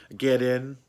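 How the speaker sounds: ordinary delivery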